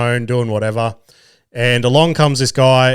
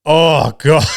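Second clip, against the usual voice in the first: drawn-out groaning "oh God"; fed-up loud groan; exasperated anger; 3 semitones higher, 4 dB louder than usual. Real, not acted. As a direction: let the irritation burst out, breaking into a laugh. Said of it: "can't even convey how fucking angry".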